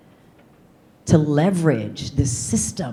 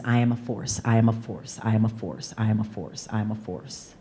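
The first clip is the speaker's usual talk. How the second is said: softer and softer with each I am a force; affirmation fading softer; 6 semitones lower, 8 dB quieter than usual. Shown as a demonstration. Repeating her favorite affirmation to herself, softly fading. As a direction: let the repeated mantra sink into near silence. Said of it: "My most recent favorite one is".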